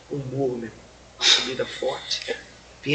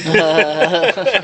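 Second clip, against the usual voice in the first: loud laugh; 3 semitones higher, 11 dB louder than usual. Real, not acted. Laughs at the crying-dream story.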